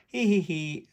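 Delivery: light giggling hee hee; giggle. A narrator's reading. Everybody laughing at the growl, light giggle. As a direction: giggle it brightly. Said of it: "everybody laughs".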